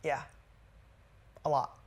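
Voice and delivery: low, soft retold admission; subdued, halting reply; a little emotional. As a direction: quietly act out a man getting a touch emotional. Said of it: "he got a little emotional".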